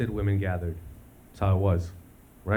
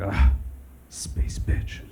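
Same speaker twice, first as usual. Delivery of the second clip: wistful whisper; much slower than usual. Retold or imitated. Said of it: "you're gonna be like".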